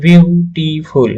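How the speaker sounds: Beautiful drawn out slowly, syllable by syllable; broken into syllables. Shown as a demonstration. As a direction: say beautiful slowly in three separate parts. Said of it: "ভেঙে ভেঙে উচ্চারণ করা".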